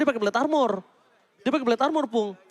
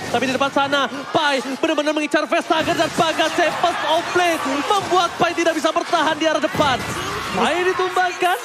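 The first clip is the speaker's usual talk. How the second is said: loud play-by-play shout; fighting game announcer; 5 semitones higher, 4 dB louder than usual. Acted. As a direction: call the fight at full force. Caster calls the teamfight.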